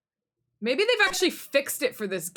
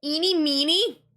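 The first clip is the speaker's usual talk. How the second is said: put-on "Eeny Meeny" drawn out high, in Valley Girl style; slow Valley Girl lilt on "Eeny Meeny"; Valley Girl accent; 8 semitones higher, 4 dB louder, slower than usual. Girl gives her name, Valley Girl accent. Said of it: "hear my Valley Girl accent".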